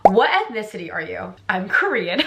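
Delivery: usual speaking voice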